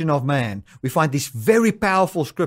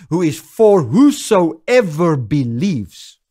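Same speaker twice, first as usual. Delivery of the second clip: slow emphatic; 5 dB louder, much slower than usual. Acted. Closing quote drawn out.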